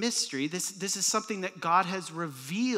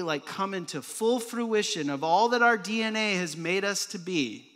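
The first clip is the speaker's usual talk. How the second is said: normal talking voice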